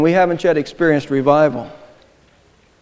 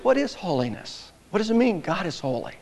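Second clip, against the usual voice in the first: soft musing; 7 dB quieter than usual. Acted. Musing question to congregation.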